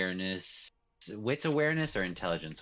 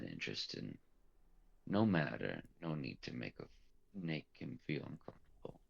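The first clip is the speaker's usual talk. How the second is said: low, softer murmured voice; low whisper; 5 semitones lower, 6 dB quieter than usual. Acted. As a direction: keep it low and private. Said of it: "just whispers well enough".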